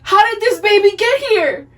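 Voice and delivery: panicked dad shouting in shock; shocked yell. Retold or imitated. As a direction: blurt it out in disbelief. Dad shocked by real baby.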